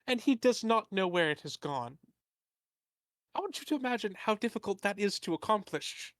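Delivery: lightly voiced fussy merchant; a hint of a fretful, rambling tradesman. Merchant NPC pleading his case.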